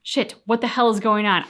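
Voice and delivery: usual speaking voice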